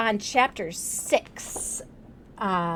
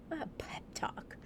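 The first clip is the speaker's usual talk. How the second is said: breathy whisper of the phrase; whispered aside; 5 semitones higher, 14 dB quieter than usual. Real, not acted. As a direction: drop to a whisper for emphasis. Whispered echo of quoted phrase.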